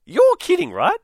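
sudden high, loud disbelief; you're kidding, thrown up loud and high; incredulous. Real, not acted. Host reacting to the neighbour story, loudly incredulous.

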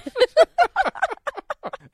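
squealing laugh up high; real high-pitched laugh; high burst of laughter. Real, not acted. Laughing at Gwyneth joke.

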